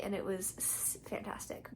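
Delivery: hushed whisper; breathy whispered aside. Real, not acted. Whispered aside while gaming.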